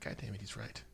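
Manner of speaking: grumbling under breath. Acted. Grudging admission.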